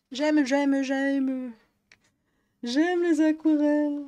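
loud, high, gushing over the paints; over-the-top fangirling; gushing excitement. Real, not acted. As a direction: let the joy burst out, drawing out les aquarelles. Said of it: "faut que je me calme".

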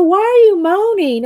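high, loud mock demand; imitating puzzled husband. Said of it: "And he'd go".

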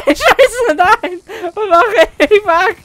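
high, laughing panic; panicked yelling. Real, not acted. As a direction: squeal it out in laughing alarm. Panicked gamer losing a fight.

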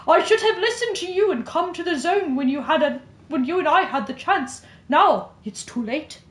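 careful attempt at British, leaning Irish; British-Irish accent. Shown as a demonstration. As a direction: read it in British drifting towards Irish. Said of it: "it's a little bit Irish".

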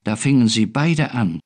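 ordinary delivery, nothing marked

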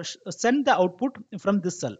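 normal talking voice

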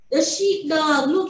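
normal talking voice

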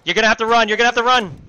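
yelling at teammates to run; urgent battlefield shout; man down shout. Real, not acted. Teammates under fire, yelling.

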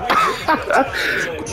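short high-pitched laugh; mocking laugh. Real, not acted. Gamer mocking a garbage opponent, high laugh. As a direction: burst out laughing at them.

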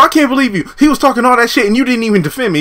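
high, fast aggrieved complaint; high-pitched hurt accusation; Pam impression. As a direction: whine it like a wronged girlfriend. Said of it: "I can't really do a good Pam impression".

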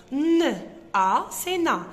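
N, A spelled loud and separate, then na; sounding out. Shown as a demonstration. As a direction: build na from its letters. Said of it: "pour construire la syllabe na".

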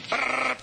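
high rattling brrr; machine gun imitation.

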